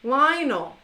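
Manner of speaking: "Lionel" said LIE-nul, falling; repeating the name after the app; copying the translator. Shown as a demonstration. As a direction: echo the app's "Lionel" in own voice. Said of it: "copying the translator".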